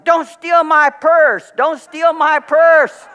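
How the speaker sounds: high, loud, slow mock yell of an elderly woman; old woman yelling. Pastor imitates the elderly woman yelling at him in the lot. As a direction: shriek it like a panicked old lady. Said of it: "She starts yelling".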